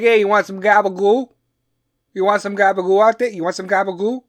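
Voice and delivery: loud, high East Coast Jersey guy; Jersey accent. Acted. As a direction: put on a pushy Jersey Italian-American voice. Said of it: "Jersey East Coast accent".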